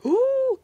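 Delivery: high, loud shocked "ooh"; crowd's gasp. Retold or imitated. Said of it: "everybody's gasping".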